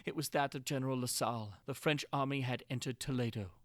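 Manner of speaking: usual speaking voice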